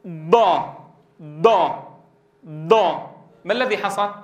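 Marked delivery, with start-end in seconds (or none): 0.1-3.1 ضا pressed on the contact zone so it sounds like a heavy dal; wrong dad as emphatic dal. Shown as a demonstration. Teacher showing students the mistaken ض. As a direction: say ضا like a thick د. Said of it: "نطقنا دالا مفخمة".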